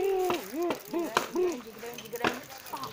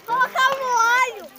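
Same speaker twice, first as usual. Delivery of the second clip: excited shout; 8 semitones higher, 12 dB louder, slower than usual. Real, not acted.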